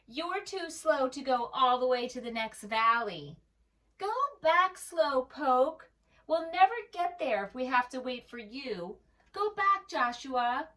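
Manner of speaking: high, loud, jeering playground taunt; taunting sheep. Acted. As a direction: sneer it out like bullies chasing him off. Sheep mock the limping lamb.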